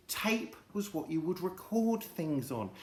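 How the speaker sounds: ordinary delivery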